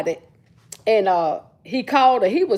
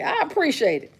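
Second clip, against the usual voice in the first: ordinary delivery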